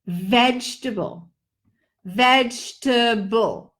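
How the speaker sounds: "vegetable" squeezed into three beats, VEJ-ta-bul; "vegetable" clipped to three syllables. Shown as a demonstration. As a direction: say "vegetable" with no middle e. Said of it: "Three beats, three syllables".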